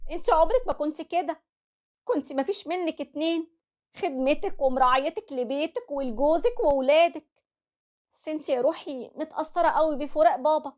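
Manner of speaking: calm her down like a loving mother; soothing mother. Acted. Mother comforting her broken daughter, tender.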